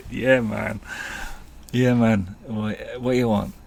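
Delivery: hammed-up Jamaican accent, drawn-out opening words; bad Jamaican accent. Rasta asking the boys' order, mock Jamaican accent. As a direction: imitate a laid-back dealer in mock Jamaican. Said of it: "worst Jamaican accent you're ever gonna hear".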